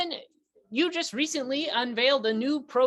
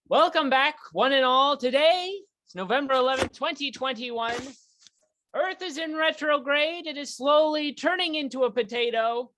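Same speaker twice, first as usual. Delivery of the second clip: louder, hammed-up talk show opening; show host intro; 2 semitones higher, 4 dB louder than usual. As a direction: welcome the audience like a grand host.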